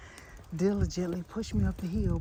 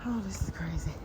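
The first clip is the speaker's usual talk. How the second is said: breathless whisper; 6 dB quieter than usual. Real, not acted. Exhausted walking up the hill.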